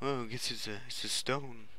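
soft, lightly voiced dopey guard; a hint of a dim-witted guard; mocking dim guard. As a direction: mimic a clueless guard shrugging. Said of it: "they'll be like".

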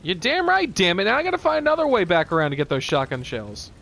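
loud, high frustrated griping; annoyed gamer venting at full voice; exasperated outburst. Real, not acted. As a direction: burst out loudly, then run down the complaint. Frustrated at a setback in game.

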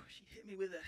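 ordinary talk

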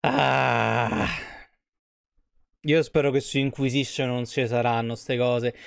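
ordinary talk, nothing marked

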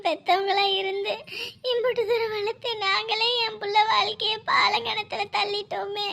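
normal talking voice

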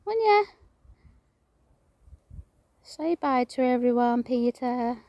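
high, sing-song voice for talking to a pet. Real, not acted. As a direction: coo it to a little pet bird. Cooing to pet bird Peter.

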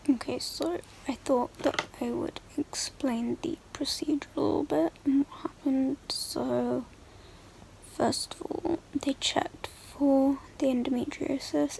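hushed whisper, mum asleep nearby. Real, not acted. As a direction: keep it to a whisper so nobody wakes. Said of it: "I have to whisper 'cause my mum's asleep".

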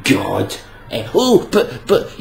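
usual speaking voice

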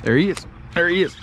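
normal talking voice

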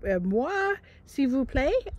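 hammed-up French accent, rising at the end; mock French accent asking a stranger for help; fake French accent. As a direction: put on a clumsy French accent like a tourist. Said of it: "she said it better than I did".

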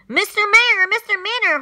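high, loud urgent call. A narrator's reading. Horton calling urgently down to the mayor, high and loud. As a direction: call out to someone far below. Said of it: "Horton called out".

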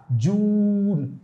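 जून with a very long, level oo; model pronunciation. Shown as a demonstration. Teacher shows the sound in a word, drawing out जून. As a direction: stretch जून out slowly for learners. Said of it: "repeat after me".

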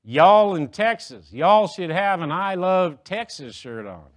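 slow, loud Texan drawl, imitating a local; mock Texan tourist greeter, drawn out; hammed-up Texas accent, slow and loud. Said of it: "a Texan comes up to me and goes".

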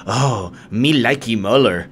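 slow, dumb lovestruck voice; mock dopey commenter. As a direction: play a simple-minded fan. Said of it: "there was the comments like".

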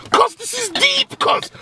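squeaky high, loud exclamation; mock outrage. Ranting to his friend about cider, squeaky high outrage. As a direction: pitch way up in mock disbelief.

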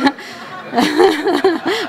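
real laugh, high and loud. Real, not acted. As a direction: burst out laughing at the joke. Laughs at own impression.

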